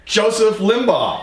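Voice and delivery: Joseph Limbaugh! called out high, slow and laughing; grand announcer call. Shown as a demonstration. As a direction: announce the name like a game-show host. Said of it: "I like it when you say my name better".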